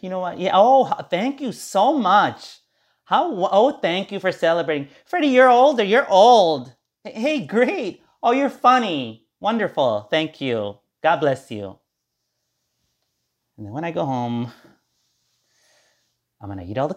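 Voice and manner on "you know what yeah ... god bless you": much higher, louder gushing fake thanks; fake gratitude. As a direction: overdo the delight so it's clearly put on. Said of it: "I'm just gonna fake it".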